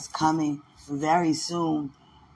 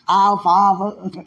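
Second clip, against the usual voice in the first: slow, laughing Our Father, a touch louder; 3 semitones higher, 6 dB louder, much slower than usual. Shown as a demonstration. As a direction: drag it out through a laugh. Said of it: "Not Father, our Father".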